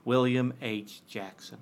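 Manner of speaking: reading in a light Georgia accent. Shown as a demonstration. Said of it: "Can you read this in a Georgian accent".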